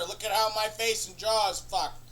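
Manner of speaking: ordinary delivery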